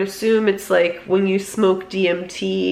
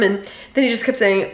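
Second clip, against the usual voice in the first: usual speaking voice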